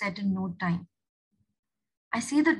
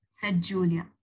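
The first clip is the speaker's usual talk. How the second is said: ordinary delivery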